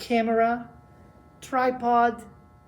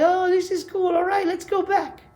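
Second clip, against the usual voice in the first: higher, breezy mock-casual quote; carefree hikers; 7 semitones higher, 4 dB louder than usual. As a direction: toss it off like it was nothing. They were like.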